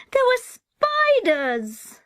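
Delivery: high, loud and slow, recoiling from the memory; a frightened little boy wailing at a memory; horrified child. Acted. Child recalls spiders in horror.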